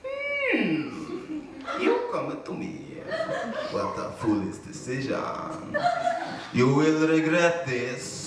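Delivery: slow menacing villain voice. Retold or imitated. Igor threatening the would-be hero, slow villain voice. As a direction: drawl it like a movie villain. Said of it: "he looks me in the eyes and says".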